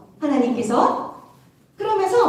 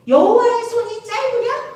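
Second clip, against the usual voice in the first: challenging question; 6 semitones higher, 3 dB louder than usual. Acted. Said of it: "그리고 물어봐".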